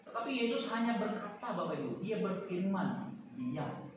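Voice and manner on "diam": quiet, low, unhurried order; Jesus calmly silencing the storm, quiet; calm command. Retold or imitated. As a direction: say it softly, certain it will obey. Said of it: "Saya yakin Yesus nggak teriak".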